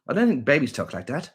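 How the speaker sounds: usual speaking voice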